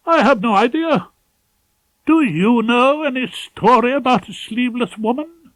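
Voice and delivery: a nervous pompous senior barrister with urgent news; fussy, pompous head of chambers. Acted. Ballard's voice.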